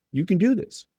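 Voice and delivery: pitched well up, rising and bright on do; upbeat encouragement. Shown as a demonstration. Coach telling a kid he can do it, upbeat and high. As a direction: cheer the player on, lifting at the end. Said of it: "that's the inflection of that opportunity".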